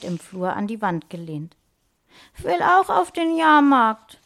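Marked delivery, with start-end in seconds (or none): 2.4-4.2 put-on high, loud childish wail; acted tearful child, pitched up; sobbing little girl. A narrator's reading. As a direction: whine it as a tearful six-year-old. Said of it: "schluchzte sie".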